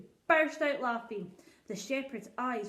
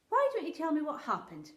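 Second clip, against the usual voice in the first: a king asking kindly but distracted; lightly voiced king; 7 semitones higher, 6 dB louder than usual. King asks the shepherd.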